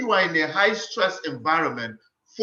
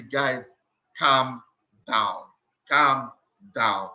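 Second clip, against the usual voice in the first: low, slow, measured calm down; paused, low words to calm a scared team; soothing demo; 6 semitones lower, much slower than usual. Shown as a demonstration. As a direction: settle a panicked crowd with each word. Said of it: "start by getting the most panicked people".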